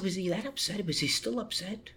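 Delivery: high, soft re-enacted worry; son questioning mother. Son asking mother about his father, high and anxious. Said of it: "I said".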